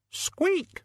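very high questioning squeak; tiny shrill squeak; high rat squeak. Acted. Death of Rats squeaking after the sledge, very high.

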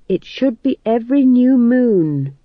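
put-on young witch insisting on the rules; stern. A narrator's reading. Magrat scolding the older witches, sternly. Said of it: "said Magrat sternly".

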